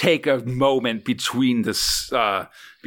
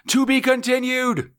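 punchy dramatic comic narration; 11 semitones higher than usual. Pretend. Dramatic end-of-story sign-off.

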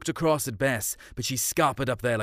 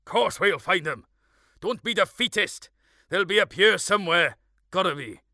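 high, raised firm call; calling back; 8 semitones higher, 3 dB louder than usual. A narrator's reading. Father calling back to the flagging Evans, raised firm call. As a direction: buck him up from a distance. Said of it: "Dad called back to Evans".

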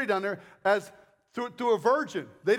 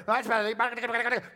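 smug comparison; much slower than usual. Retold or imitated. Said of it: "And they're comparing".

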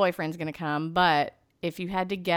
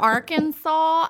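drawn-out Arkansas; 10 semitones higher, 5 dB louder, slower than usual. Real, not acted. Mock-drawn out place name.

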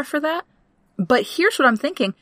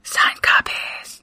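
whisper; much slower than usual. Pretend. Whispers echo of joke.

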